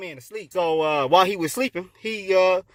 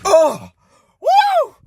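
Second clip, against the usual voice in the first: very high, loud "woo"; ecstatic whoop; 12 semitones higher, 7 dB louder than usual. Acted. Adam tastes the apple, ecstatic whoop. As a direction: whoop with delight.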